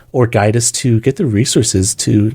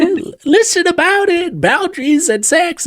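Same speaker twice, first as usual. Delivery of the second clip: much higher, slowed newsboy call; hawking newsboy. Acted. [name] joining the newsboy bit, selling relationships and boundaries in a high call. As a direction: call it out like a street paperboy.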